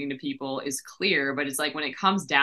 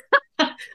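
high nervous laugh; 4 dB louder than usual. Real, not acted. Laughs at own confusion.

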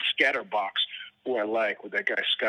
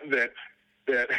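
usual speaking voice

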